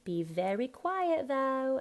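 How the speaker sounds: high "though" said as vo; Cockney th-as-v. Shown as a demonstration. Coach modelling Horace's line, Cockney th-as-v. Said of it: "T H of though is a V".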